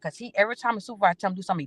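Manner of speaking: usual speaking voice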